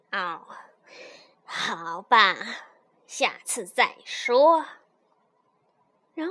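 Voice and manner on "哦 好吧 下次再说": low, grudging snake giving up; grumbling snake. A narrator's reading. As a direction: grumble it slowly. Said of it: "咕哝道".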